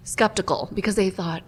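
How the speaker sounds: ordinary talk, nothing marked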